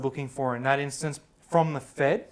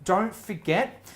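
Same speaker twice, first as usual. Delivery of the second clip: higher, louder programme outro; presenter sign-off; 4 semitones higher, 5 dB louder than usual. Acted. Host thanking guest and closing the episode, projected presenter sign-off.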